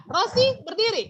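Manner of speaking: "Rosi berdiri" as a loud order, pitched high then falling; command intonation. Shown as a demonstration. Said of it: "Mem lagi sebutkan kalimat perintah". ("Rosi berdiri" dengan nada perintah yang lantang)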